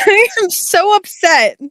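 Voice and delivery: high, laughing protest; laughing upset. Real, not acted. Reader breaking up over the book, laughing exasperation. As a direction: wail it helplessly through real laughter.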